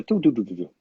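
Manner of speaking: quick run of spoken "doo" beeps; imitated beeping noise. Actor tells co-host about a phone noise, imitated beeping. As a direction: mimic a little electronic alert with doo-doo-doo. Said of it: "I heard a".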